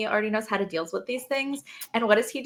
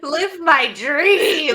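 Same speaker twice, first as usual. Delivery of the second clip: mock dramatic; 7 semitones higher, 8 dB louder, much slower than usual. Listener echoes, dramatic.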